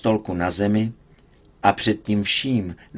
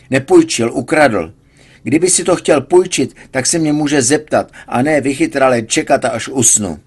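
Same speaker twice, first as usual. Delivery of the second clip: indignant protest; 5 semitones higher, 6 dB louder than usual. A narrator's reading. Patient argues with nurse.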